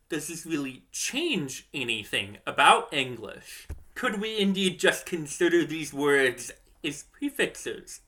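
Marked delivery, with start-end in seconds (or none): none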